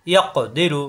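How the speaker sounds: ইয়াক দিরু with ক bounced before দিরু; qalqalah bounce. Shown as a demonstration. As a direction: release the ক of ইয়াক with a short echo. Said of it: "বাড়ি খাবে".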